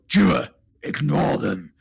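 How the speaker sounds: gruff monster telling off its twin; grumpy left head. Pretend. Left head tells the other to ignore them, gruff. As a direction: dismiss them in a creature voice. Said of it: "the left head goes".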